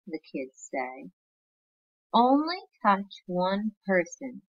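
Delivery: slow, clear read-aloud storytelling; teacher reading a storybook to her class; reading to children. Acted. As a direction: read it slowly like a picture book to kids.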